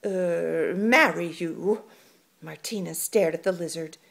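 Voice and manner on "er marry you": hissed. Acted. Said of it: "he hissed".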